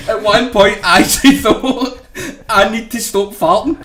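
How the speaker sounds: loud, laughing retelling; can barely get it out for laughing; laughing quote. Retold or imitated. Quoting Grado's fart story, crying with laughter. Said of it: "He says".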